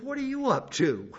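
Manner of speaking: high-pitched comic question with a laugh; mock questioning. Preacher recalls confronting the rooster in his garage, mock questioning. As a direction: ask it high and amused.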